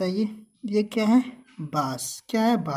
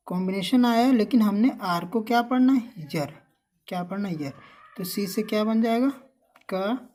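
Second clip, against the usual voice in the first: ordinary delivery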